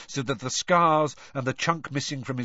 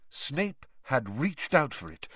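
ordinary delivery, nothing marked

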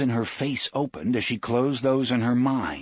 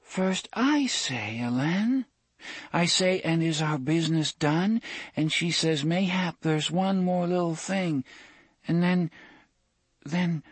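put-on petulant little girl; 10 semitones higher than usual. A narrator's reading. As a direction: speak as a small, whiny girl. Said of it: "The faintly petulant voice of a little girl".

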